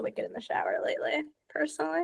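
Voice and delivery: a hushed little confession; whispered aside. Real, not acted. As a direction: whisper the last word. Confessing a music habit.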